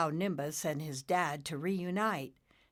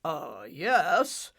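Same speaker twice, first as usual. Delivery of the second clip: smug; 6 dB louder than usual. Acted. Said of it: "Cumulo said smugly".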